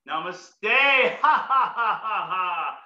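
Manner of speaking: slow bowing namaste then forced ha ha laughs; bow and laugh out loud on each ha; namaste laughter. Shown as a demonstration. Leading the class in namaste laughter. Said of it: "namaste laughter".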